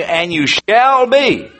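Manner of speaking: SHALL drawn out long, high and emphatic; preacher's ringing emphasis on "shall be"; emphatic statement. Shown as a demonstration. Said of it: "That's an emphatic statement".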